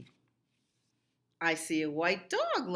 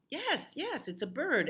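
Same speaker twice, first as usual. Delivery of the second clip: excited; 11 semitones higher than usual. Acted. Excited reveal of picture.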